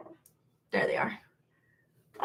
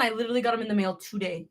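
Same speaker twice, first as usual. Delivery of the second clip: ordinary talk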